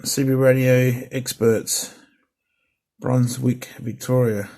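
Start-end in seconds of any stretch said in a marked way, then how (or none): none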